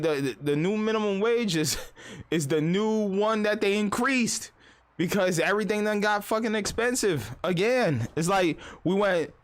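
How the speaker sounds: ordinary talk, nothing marked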